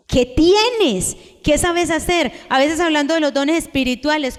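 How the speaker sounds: ordinary talk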